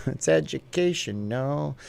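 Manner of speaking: amused, slow "educational"; drawn-out word. Acted. Streamer savouring a viewer's comment, drawn-out and amused. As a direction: stretch out "educational" syllable by syllable.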